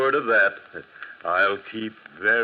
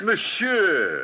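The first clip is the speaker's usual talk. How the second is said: indignant outburst; 11 semitones higher than usual. Acted. Gendarme insulted by the banker.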